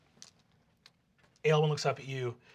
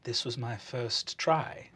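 quiet admission; 5 semitones lower, 6 dB quieter, slower than usual. Acted. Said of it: "she says".

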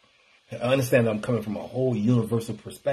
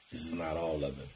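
much softer, lower repeat; soft echo; 7 semitones lower, 13 dB quieter than usual. Real, not acted. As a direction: let it fall away like an echo. Repeated line, then dropped to quiet.

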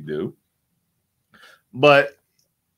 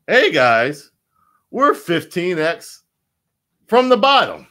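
higher, louder hype-man impression of Richard Heart; mocking Richard Heart; 8 semitones higher, 8 dB louder than usual. Mocking Richard Heart bragging to his holders, high and loud hype. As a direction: hype it up like a crypto founder bragging. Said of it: "you hear Richard Hart say".

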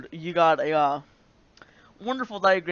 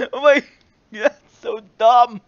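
laughing; 4 semitones higher, 10 dB louder than usual. Real, not acted. Laughing at paper.

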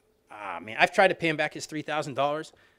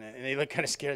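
ordinary delivery

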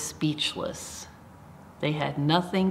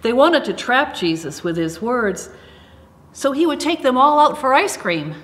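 playful tease; 7 semitones higher, 6 dB louder than usual. Acted. Deliberately wrong silly story line.

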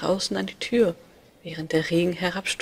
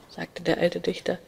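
plain reading voice

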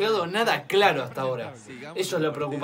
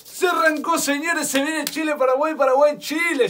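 play-by-play; 9 semitones higher, 6 dB louder than usual. Real, not acted.

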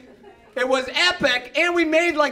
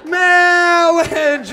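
loud, high, long-drawn "mawwage"; hammed-up minister, booming and stretched out; Princess Bride minister; 6 semitones higher, 7 dB louder than usual. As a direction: belt it out like a pompous comic clergyman. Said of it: "we snuck a Princess Bride reference".

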